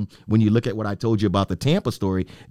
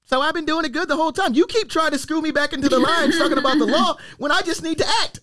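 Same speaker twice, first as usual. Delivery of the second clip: squeaky high laughing complaint, pitched way up; laughing protest. Real, not acted. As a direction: laugh through a mock-offended whine. Mock protest after being teased.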